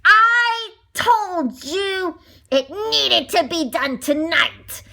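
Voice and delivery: mock shout. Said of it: "she shouted at them".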